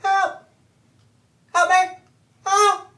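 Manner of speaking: tiny, very high cry for help; little voice. Acted. Little gnome caught in a flytrap, high pleading cries. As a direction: squeal it loudly like a small creature. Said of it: "you hear a little voice like".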